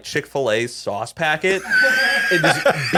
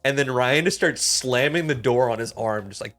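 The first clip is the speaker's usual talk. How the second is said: ordinary talk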